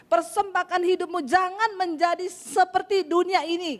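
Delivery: urgent exhortation to the congregation; forceful preaching. Real, not acted. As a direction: preach it hard, punching key words.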